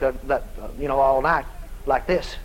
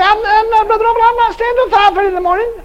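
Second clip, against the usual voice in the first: high, far-off mock complaint; lightly mocking voice of a listener; whiny churchgoer; 14 semitones higher, 5 dB louder than usual. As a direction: mimic a reluctant attendee.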